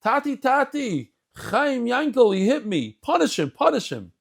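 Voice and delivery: put-on high, pleading child tattling to his father; child runs to father at the door, high and whiny; whiny little kid. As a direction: play a small kid crying to daddy for revenge. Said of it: "what's the first thing some of the kids say".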